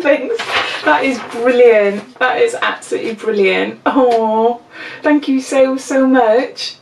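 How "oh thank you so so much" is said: ordinary talk, nothing marked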